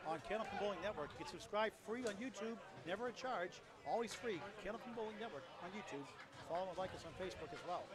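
fast ad read between shots; channel promo read. Acted. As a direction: rattle off the subscribe plug. Promo read mid-broadcast.

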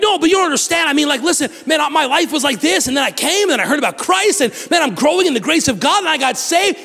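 high, fast, eager pleading; earnest believer. Retold or imitated. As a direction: talk fast to convince a doubter. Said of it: "And you're like".

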